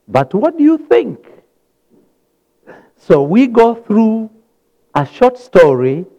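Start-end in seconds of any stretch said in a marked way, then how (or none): 0.1-1.2 But what do you think? jumped much higher, stress on you; emphatic question. Shown as a demonstration. Preacher repeats the Bible's question to the congregation, much higher, stressing you. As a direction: lift it high, pressing on you. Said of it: "The Bible is asking".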